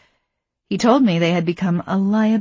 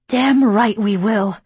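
growled; 4 semitones higher, 6 dB louder, slower than usual. Acted. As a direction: growl it through clenched teeth. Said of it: "Pauline growled".

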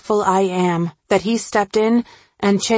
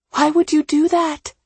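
hurt disbelief; 9 semitones higher than usual. A narrator's reading.

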